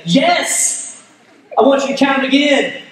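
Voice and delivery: emphatic inner demand. Retold or imitated. Anxious husband's unspoken answer to his wife, emphatic.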